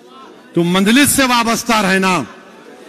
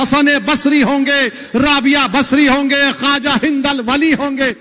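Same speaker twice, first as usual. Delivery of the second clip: preaching at full force; 4 semitones higher than usual. Acted. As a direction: climb higher with every name like a rising sermon. Rising list of holy names. (پرجوش خطابت)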